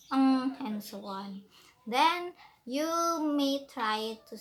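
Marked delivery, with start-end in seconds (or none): 0.1-0.6 "ng" as one hummed nasal sound; isolated nasal sound demo. Shown as a demonstration. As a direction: sound out "ng" on its own, firmly. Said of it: "start to practice by saying the sounds".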